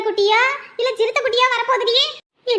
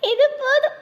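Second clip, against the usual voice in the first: choked up with joy, pitched higher; joyful crying; 5 semitones higher than usual. Acted. Mother weeps with joy at the pregnancy news, tearful. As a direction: voice breaking into happy tears.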